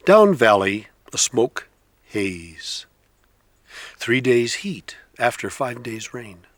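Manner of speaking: measured poetry reading with long pauses between lines; poem reading. Acted. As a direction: let each image hang in the air, unhurried. Poet reads his own poem.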